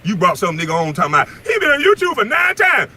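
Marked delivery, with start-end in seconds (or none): 1.5-2.9 mock him in a jeering high yell; mocking imitation. Ranting at a rival, mocking his guest in a jeering high yell.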